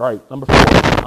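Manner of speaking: calling out the next point loud; loud pulpit announcement. Real, not acted. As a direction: boom out the number.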